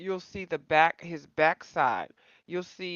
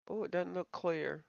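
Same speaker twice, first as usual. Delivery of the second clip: ordinary delivery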